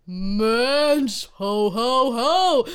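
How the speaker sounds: Santa laugh. Acted.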